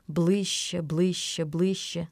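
soft, tense countdown of approach; hushed suspense. A narrator's reading. Boy watching the angry grandpa close in, hushed.

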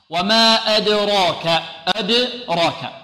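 وما أدراك drawn out slowly, each syllable held; slow recitation. Shown as a demonstration. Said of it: "أدراك كلمة كذلك".

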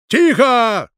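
loud, high, drawn-out bellow; roared command. A narrator's reading. Doctor silences the room full of hysterics, bellowing. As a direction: roar over the commotion. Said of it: "рявкнул он, перекрыв всеобщий гомон".

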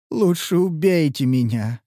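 ordinary delivery

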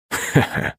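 soft, breath-only snicker; sly chuckle. A narrator's reading. Son finds a loophole in his promise, sly chuckle. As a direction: chuckle quietly to yourself.

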